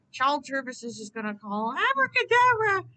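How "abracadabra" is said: high, ringing magic word; loud spell incantation. Acted. Wizard zapping Baldy, high and loud. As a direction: shout the spell triumphantly.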